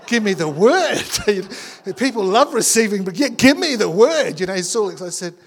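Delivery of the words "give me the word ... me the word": slow, loud mock demand for a word; playful imitation of a hungry listener; eager demand. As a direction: ham up an eager man wanting his prophecy. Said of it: "he's looking at me with like".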